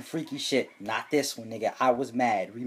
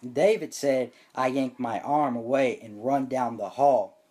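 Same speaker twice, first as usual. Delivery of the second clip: usual speaking voice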